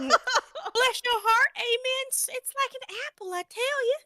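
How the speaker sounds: high, very slow, syrupy blessing; sweet preacherly monster. Pretend. Co-host plays a sweet monster blessing its meal, high and slow. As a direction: savour every word like a church lady. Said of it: "just sweet voice?".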